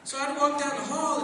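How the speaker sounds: ordinary delivery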